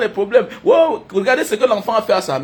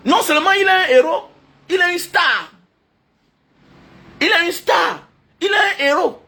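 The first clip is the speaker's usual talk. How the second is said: excited proclamation; 7 semitones higher than usual. Real, not acted. Proclaiming his hero.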